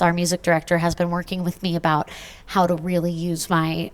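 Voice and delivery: ordinary talk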